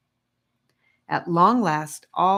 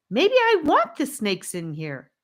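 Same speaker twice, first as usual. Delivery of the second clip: Charlie shouting, higher; defiant, loud boy's shout; 8 semitones higher, 3 dB louder than usual. A narrator's reading. Charlie shouts back at the aggressive captive, defiant. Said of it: "shouted Charlie".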